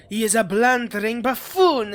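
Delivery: loud, contemptuous jab at the brother; loud sneering contempt; scornful outburst. Acted.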